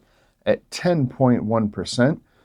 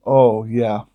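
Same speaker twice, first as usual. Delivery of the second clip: ordinary talk, nothing marked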